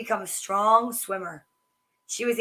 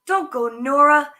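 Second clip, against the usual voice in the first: pleading cry, a touch louder; 3 semitones higher, 7 dB louder than usual. Acted. Bear cub begs Nora not to go, pleading cry. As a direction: beg her not to leave. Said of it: "cried Bear".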